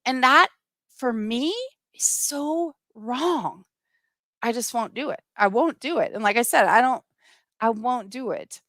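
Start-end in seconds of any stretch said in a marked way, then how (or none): 1.9-3.7 emotional. Real, not acted. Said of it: "it makes me emotional right now".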